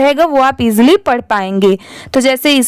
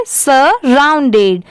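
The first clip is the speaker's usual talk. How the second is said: Surrounded very slow, "sa... round-ded"; slow repeat-after-me; much slower than usual. Shown as a demonstration. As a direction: split surrounded slowly for copying. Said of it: "एक बार फिर से repeat करिए". (Surrounded धीरे-धीरे, तोड़कर)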